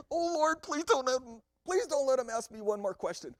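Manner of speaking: high, loud, rushed plea to the Lord; panicked liar's prayer. Retold or imitated. Jacob praying in a panic not to be questioned. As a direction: beg God fast so the questions stop. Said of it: "you're praying".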